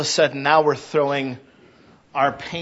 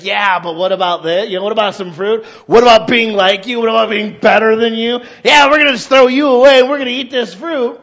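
ungrateful demanding; 5 semitones higher, 9 dB louder than usual. Retold or imitated. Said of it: "we were just like".

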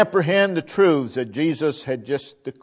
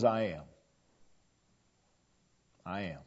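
hushed I am; 8 semitones lower, 13 dB quieter than usual. Acted. Preacher voicing Jesus softly.

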